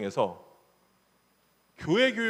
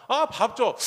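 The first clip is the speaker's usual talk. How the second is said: put-on higher, louder childish whine for food; whiny child; 6 semitones higher, 4 dB louder, much slower than usual. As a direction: whine like a hungry kid. Child begging mom for food. (밥 달라고 징징대는 아이)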